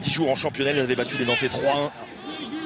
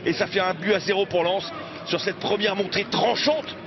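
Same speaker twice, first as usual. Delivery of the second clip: high, driving goal call; excited sports commentary; 9 semitones higher than usual. Acted. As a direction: celebrate the goal on air.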